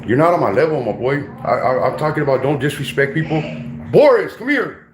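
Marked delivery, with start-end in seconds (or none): 3.9-4.9 calling the dog from across the room, raised high. Real, not acted. Calling his dog Boris over from the room.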